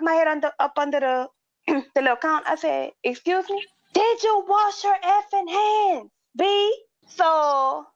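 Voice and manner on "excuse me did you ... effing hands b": high, slow stunned question; incredulous. Retold or imitated. Cashier repeating the customer's insult back, high and disbelieving. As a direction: throw it back at him in disbelief.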